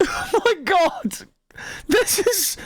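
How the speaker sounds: high, slow laughing gasp; laughing disbelief. Real, not acted. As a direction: squeal it through helpless laughter. Reacting to the story's dark turn.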